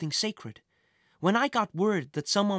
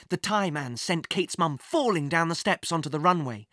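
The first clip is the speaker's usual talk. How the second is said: plain reading voice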